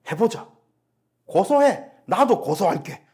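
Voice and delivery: high, loud taunting voice; jeering high-pitched threat; mocking threat. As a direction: play the spiteful accuser. Voicing opponents threatening to sue.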